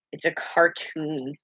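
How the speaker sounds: a lightly done New York accent, slow and soft. Shown as a demonstration. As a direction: say it like a New Yorker, half-hearted. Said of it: "in a New York accent".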